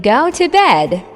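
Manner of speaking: usual speaking voice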